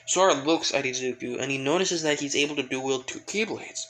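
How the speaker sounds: ordinary talk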